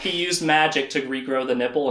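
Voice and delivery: normal talking voice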